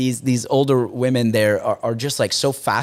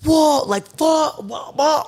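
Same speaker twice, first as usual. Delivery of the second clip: much higher, louder gasps of awe; amazed whoa; 13 semitones higher, 3 dB louder, slower than usual. Retold or imitated. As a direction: gawk at everything in wonder. Awestruck entering the collection.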